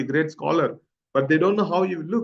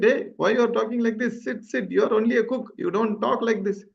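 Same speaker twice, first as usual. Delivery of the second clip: higher-pitched mock reprimand from villagers; mild retold scolding; 6 semitones higher than usual. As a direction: put the upstart cook in his place, pitched up.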